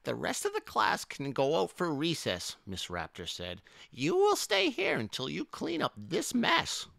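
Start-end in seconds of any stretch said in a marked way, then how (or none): 0.0-2.6 high put-on schoolmistress voice; high-pitched storybook schoolteacher; strict teacher. A narrator's reading. Teacher addresses the class. 3.9-6.9 very high-pitched schoolmistress voice; piercingly high storybook teacher; strict teacher. A narrator's reading. Teacher punishes the pupil.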